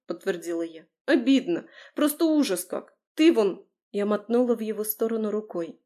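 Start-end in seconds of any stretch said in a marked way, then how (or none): 1.1-3.7 high-pitched, aggrieved outburst; hurt complaint. A narrator's reading. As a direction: pour out the grievance, pitch raised. Tearful heroine airing her hurt.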